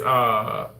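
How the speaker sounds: ordinary talk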